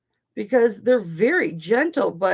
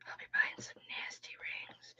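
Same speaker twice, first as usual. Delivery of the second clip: very soft, unvoiced whisper; 18 dB quieter than usual. Real, not acted. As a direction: whisper it under your breath. Whispered aside, repeated.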